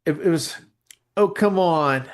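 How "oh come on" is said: ordinary talk, nothing marked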